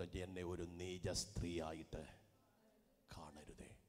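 a hint of Hannah's mournful plea; sorrowful plea. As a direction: pour it out quietly like a broken heart. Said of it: "അവൾ പറയുന്നുണ്ട്".

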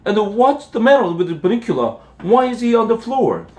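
high-pitched, indignant scolding; outraged scream. A narrator's reading. Mister Monroe finds his dinner stolen, high indignant yelling. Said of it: "Mister Murnau screamed".

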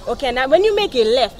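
normal talking voice

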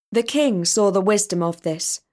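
ordinary delivery, nothing marked

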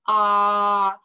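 "ah" said loud and open; ah sound. Shown as a demonstration. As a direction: sound out a clear "ah". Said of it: "the first sound".

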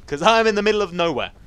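high, loud outburst; exclaimed complaint. Real, not acted. Gamer lost in a cave, loud complaint. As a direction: lift and push the whole phrase. Exclaims, then repeats plainly.

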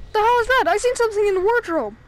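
high, loud startled cry; startled alarm. Real, not acted. Gamer startled by something in the wardrobe, loud. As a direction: blurt it out in alarm.

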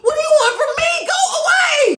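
high, shrieking horror at the screen; horrified screaming. Real, not acted. Streamer screams at the video in horror. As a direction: screams begging it to go away.

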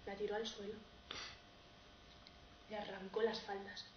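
hushed, halting storytelling; grave dramatic narration. Acted.

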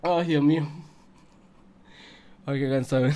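low, soft, worn-out voice after yelling; hurt throat, tired. Real, not acted. Streamer who hurt his throat shouting, drained and quiet. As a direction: speak low and spent, sparing a sore throat. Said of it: "mi garganta me lastimé".

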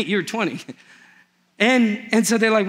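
ordinary delivery

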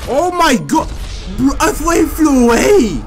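loud, high, startled yell; shocked shout. Real, not acted. Shocked at enemy's escape.

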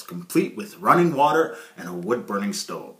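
usual speaking voice

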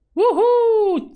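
high, loud, held "woo-hoo"; scream on demand. Shown as a demonstration. Streamer answering a chat request to scream, whooping. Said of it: "Am țipat, bă".

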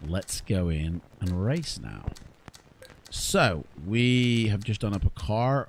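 ordinary delivery

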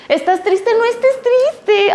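voz mucho más aguda y lenta, empalagosa; consuelo fingido. Retold or imitated. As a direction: consolar con dulzura exagerada y burlona. Adulto consolando de forma absurda. (imitando a un adulto que dice no estés triste, con burla)

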